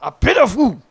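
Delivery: gruff Mister T impression. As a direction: do a Mister T line. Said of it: "Mister T".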